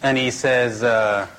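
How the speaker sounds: normal talking voice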